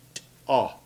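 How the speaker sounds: T-AH with a definite break between T and AH; separated t-ah. Shown as a demonstration. Teacher illustrates the separation, T-AH in two syllables. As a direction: puff out the T, wait briefly, then AH in T-AH. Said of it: "There's a definite separation between that T and A U H".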